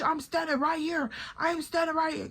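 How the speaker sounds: mock upset retort. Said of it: "'Cause now I'm upset".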